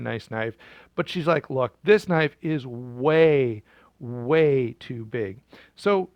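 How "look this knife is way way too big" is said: slow, stretched "way, way too big"; slowly stretched "way, way too big"; drawn-out complaint. Acted. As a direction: drag out "way, way" to show how big. Goldilocks complains knife too big.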